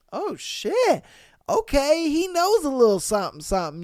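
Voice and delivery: high, surprised and impressed; impressed woman. Retold or imitated. Women surprised by a guy's language, high and impressed. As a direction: lift the voice in happy surprise. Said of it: "it does get them like".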